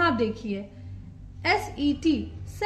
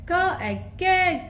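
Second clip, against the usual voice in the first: प, एग, पेग sounded out slowly; slow teacher blending; 2 semitones higher, much slower than usual. Shown as a demonstration. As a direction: build पेग piece by piece. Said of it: "के का phonic sound होता है क".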